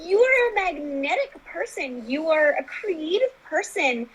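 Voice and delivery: high, slow, emphatic affirmations; encouraging therapist. Retold or imitated. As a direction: voice a therapist building up a client. Voicing therapist's uplifting speech.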